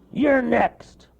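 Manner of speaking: high, loud snap; sharp cartoon bear snap; snapping bear. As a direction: bite off the words. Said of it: "he snapped".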